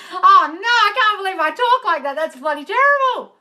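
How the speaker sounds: high, loud mock horror; appalled outcry. Real, not acted. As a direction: wail in disbelief at yourself. Mock horror at own way of talking.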